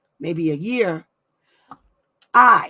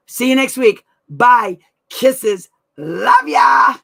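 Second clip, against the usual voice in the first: shouted goodbye; 7 semitones higher, 12 dB louder, much slower than usual. Real, not acted. Signing off to viewers.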